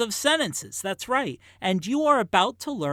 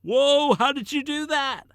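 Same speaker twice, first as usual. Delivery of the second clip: surprised high exclamation; amazed whoa; 7 semitones higher, 5 dB louder than usual. Pretend. Impressed friend reacting to a trick, amazed. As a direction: wow them like an amazed friend. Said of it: "people will be like".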